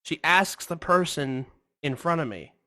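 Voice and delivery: ordinary talk, nothing marked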